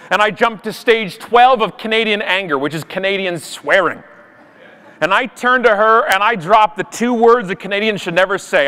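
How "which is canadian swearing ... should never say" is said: ordinary talk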